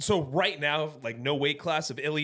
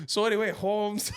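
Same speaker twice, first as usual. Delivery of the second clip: put-on Mexican accent; 6 semitones higher than usual. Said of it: "Max Holloway's Mexican".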